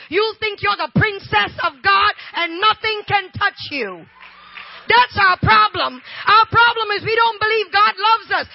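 loud, driving sermon delivery; fervent preaching. Real, not acted. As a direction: preach it with full conviction to the crowd. Preaching at full force.

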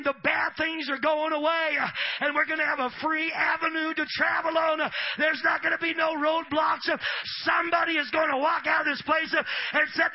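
loud shouted sermon; preaching at full force. Acted. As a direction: preach it hard to the crowd.

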